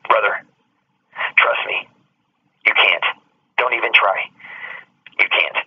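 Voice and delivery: low, soft, steady warning from a big man; calm warning. Retold or imitated. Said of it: "he grabbed my shoulder and he goes".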